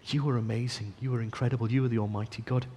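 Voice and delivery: soft reverent prayer voice; humble prayer. Acted. Preacher modelling a humble prayer to God, soft and reverent. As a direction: pray it softly and humbly. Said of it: "to just come and say".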